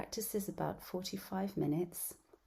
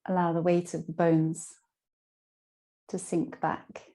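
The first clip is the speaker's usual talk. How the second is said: guided meditation; 10 dB louder than usual. Acted. Yoga teacher guiding listeners lying down through Yoga Nidra, guided meditation. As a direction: guide them down into rest, phrase by phrase.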